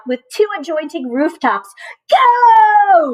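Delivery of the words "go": sharp high starting shout; loud high go shout. A narrator's reading. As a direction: yell it to start the race. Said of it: "shouted Muckle".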